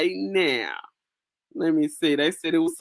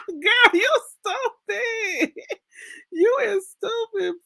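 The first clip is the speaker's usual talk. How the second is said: laughing hard; slower than usual. Real, not acted. Laughing at viewer's joke.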